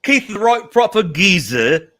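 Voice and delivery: high, loud put-on Cockney; Cockney accent. Guest reads out a fan's challenge on request, attempted Cockney accent. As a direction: lay on a hammy Cockney accent. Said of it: "Read that in a Cockney accent".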